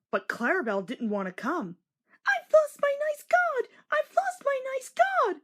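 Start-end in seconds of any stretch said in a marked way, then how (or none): 2.3-5.4 very high wailing squeak; high little coach voice, sobbing. A narrator's reading. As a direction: wail it out like a tiny distressed coach. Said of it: "she sobbed".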